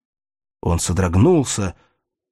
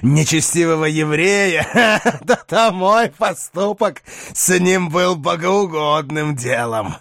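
grim forced laugh; 7 semitones higher than usual. Acted. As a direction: laugh it off darkly. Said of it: "с мрачным и натянутым смехом".

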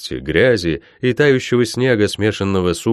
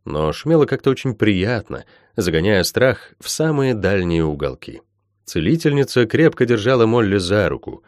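plain reading voice

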